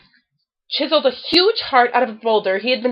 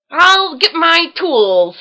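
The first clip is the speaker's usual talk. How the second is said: louder, slow, higher gleeful offer; 6 semitones higher, 6 dB louder, slower than usual. A narrator's reading. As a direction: relish the job ahead. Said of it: "said Gopher gleefully".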